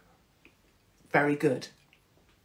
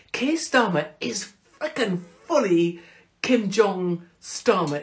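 ordinary talk

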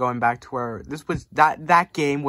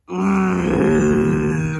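loud, raised growling groan; frustrated growl; 5 semitones higher, 7 dB louder than usual. Real, not acted. Fan laments lost number one seed, frustrated growl. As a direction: let out a long groan of frustration.

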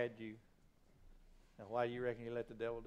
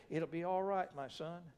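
fatherly reassurance; 5 semitones higher than usual. Acted. God to his son in the temptation, reassuring. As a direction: comfort a son, easing right down.

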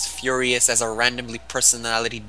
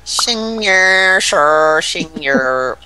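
loud, mocking fake Chinese accent; 3 semitones higher, 7 dB louder, much slower than usual. Gamer mocking Chinese players to friends, loud fake Chinese accent. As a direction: ham up a Chinese accent to mock the way they talk. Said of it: "You know that's how they talk".

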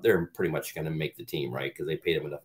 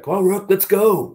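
louder, higher challenge to the rookie; calling out; 12 semitones higher, 8 dB louder than usual. Retold or imitated. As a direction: bark the rookie up to the line.

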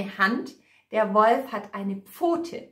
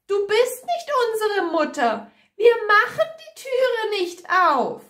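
high, loud little-goat voices; kids calling out; 11 semitones higher, 4 dB louder than usual. A narrator's reading. Kids refuse to open up for the wolf, calling shrilly high. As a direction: shout back in a high, indignant child's voice. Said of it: "Die Zicklein rufen".

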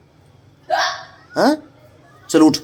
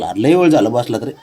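ordinary delivery

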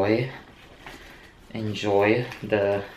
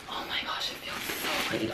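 breathless whispered awe at the bag; awed whisper; 12 dB quieter than usual. Real, not acted. Unboxing her dream bag, to viewers, an awed whisper. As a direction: whisper it like it's too precious to say aloud.

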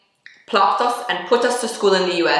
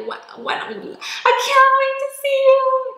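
eager quote. Retold or imitated. FaceTiming her best friend, a squealed eager quote. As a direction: gush like you can't wait to see them.

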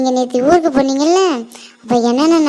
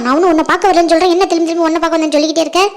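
higher, hurried irritated retort; exasperated denial; 3 semitones higher, faster than usual. Acted. Girl correcting the teasing friend, exasperated denial. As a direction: insist on it, fed up with the teasing.

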